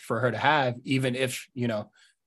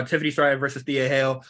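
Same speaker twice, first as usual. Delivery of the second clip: usual speaking voice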